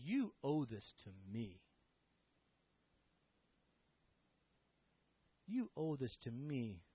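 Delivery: low, soft and deliberate; subdued, weighty insistence; quiet entitled demand. Acted. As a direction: press the claim in a hushed voice. Son's entitled demand, voiced low.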